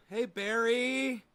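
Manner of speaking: drawn-out greeting. Real, not acted. Greeting a viewer.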